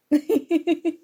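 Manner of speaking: a short high chuckle at the notes; amused laugh. Acted. Friend reading study notes, amused chuckle. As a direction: laugh lightly, caught off guard.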